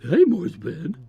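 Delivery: cartoonish send-up of a colleague; Chris. Co-host mocks his colleague to his face, exaggerated impression. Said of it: "I made that my Chris voice".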